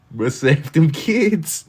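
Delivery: high, slow mock plea. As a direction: plead it high and drawn out. Mock plea after whisper.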